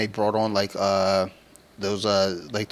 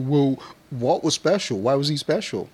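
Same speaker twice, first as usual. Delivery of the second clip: mock fan speculation; 5 semitones higher than usual. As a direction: puzzle over it like an over-invested fan. Imitating fans puzzling over theories.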